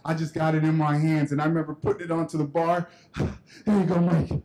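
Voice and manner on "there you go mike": acted-out panting between words; out of breath. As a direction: hand it over puffing from running the stairs. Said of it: "you sound out of breath".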